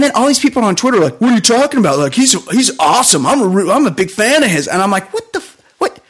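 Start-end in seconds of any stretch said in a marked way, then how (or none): 1.2-4.7 imitating fans. 5.1-6.0 incredulous. Retold or imitated. His own incredulous retort.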